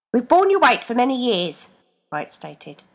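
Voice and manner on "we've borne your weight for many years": higher, louder grievance; weary reproach; aggrieved foot voice. A narrator's reading. Foot reproaching Rebecca, louder grievance. Feet complaining.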